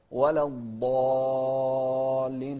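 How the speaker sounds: الضالين very long, loud, chanted aa; six-count madd. Shown as a demonstration. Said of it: "ست حركات". (مد الضالين ست حركات بترتيل)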